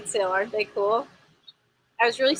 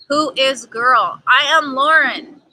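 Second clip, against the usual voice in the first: dramatic; 3 semitones higher, 8 dB louder, slower than usual. Acted. Playful self-introduction.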